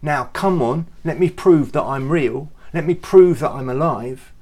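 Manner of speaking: mock angry telling-off of Thomas; impatient scolding. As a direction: tear into doubting Thomas. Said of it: "Jesus didn't go".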